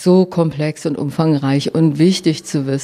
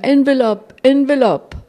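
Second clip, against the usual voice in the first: high calls, climbing on each Envelope; the guru calling Envelope as he walks off, high and loud; repeated loud call; 8 semitones higher than usual. Retold or imitated. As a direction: call it out again and again across the hall. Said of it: "rief er immer wieder".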